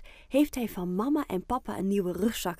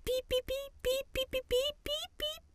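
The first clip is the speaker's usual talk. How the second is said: tiny squeaks, pitched far higher and soft; guinea pig squeaks; 5 dB quieter than usual. Acted. The guinea pig squeaks from inside the backpack, high soft squeaking. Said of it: "Er komen gekke geluiden uit".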